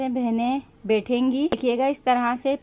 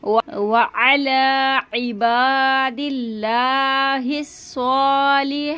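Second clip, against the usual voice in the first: slow recitation; much slower than usual. Shown as a demonstration. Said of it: "इसको खींचते हुए रुकेंगी".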